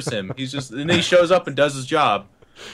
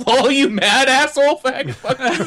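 high, loud jeer; gleeful imitation of a taunting gamer; mocking online troll; 12 semitones higher, 5 dB louder than usual. Trolls jeering at a player asking them to play, mocking. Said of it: "they're like".

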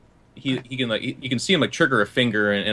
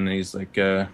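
normal talking voice

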